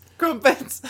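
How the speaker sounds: high, hammed-up British voice; mock British. Host echoes co-host's crumpets, high mock British. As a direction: squeal it as a cartoon Brit.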